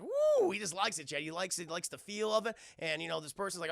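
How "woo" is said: very high whooping woo; Chris Tucker woo. Retold or imitated. Chris Tucker entering the karaoke bar, high excited whoop. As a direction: whoop it up like you love the place. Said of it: "and Chris Tucker's like".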